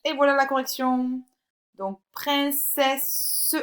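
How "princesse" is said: princesse very slow, as prin-ce-sse; syllable by syllable. Shown as a demonstration. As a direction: stretch princesse into distinct held syllables. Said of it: "sur la deuxième et la troisième syllabe".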